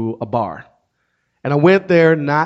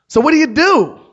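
high, loud mock-perky question; excited nosy stranger; 14 semitones higher, 8 dB louder than usual. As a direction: launch into it like an overeager chatty stranger. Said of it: "curious about everything. She was excited".

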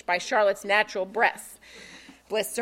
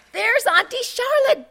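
put-on much higher, louder child's call; excited cry; 13 semitones higher, 7 dB louder than usual. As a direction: call out happily to auntie. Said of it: "Bella cried".

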